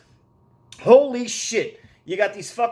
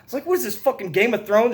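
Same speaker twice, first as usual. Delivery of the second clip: ordinary talk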